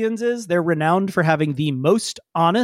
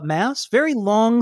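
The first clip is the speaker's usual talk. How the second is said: ordinary delivery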